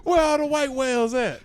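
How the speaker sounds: high, loud comedian's punchline delivery; hammed-up comic. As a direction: holler it as a mock stand-up bit. Riffing as a stand-up comic.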